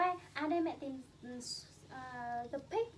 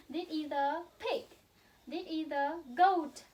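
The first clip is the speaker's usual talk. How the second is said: ordinary talk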